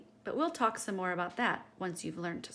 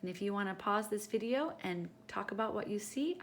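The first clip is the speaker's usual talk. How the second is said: ordinary delivery, nothing marked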